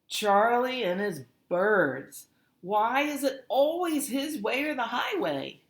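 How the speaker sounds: groaning complaint. A narrator's reading.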